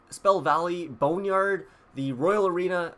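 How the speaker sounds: ordinary talk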